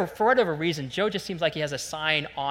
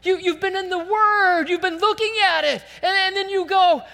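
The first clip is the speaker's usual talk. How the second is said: very high, loud, scandalized rebuke; incredulous outcry; 8 dB louder than usual. Acted. Preacher voices James scolding forgetful hearers of the Word, incredulous. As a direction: cry out in hammed-up disbelief.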